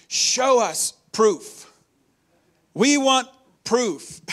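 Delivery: loud, slow, whiny demand from the scoffers; mocking Pharisees. Preacher mocking the Pharisees' demand for proof, loud and slow.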